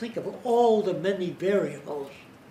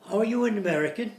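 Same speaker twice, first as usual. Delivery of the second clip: imitated English; 5 semitones higher, 4 dB louder, faster than usual. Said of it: "If, if he's English".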